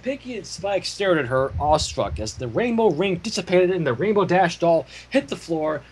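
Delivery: usual speaking voice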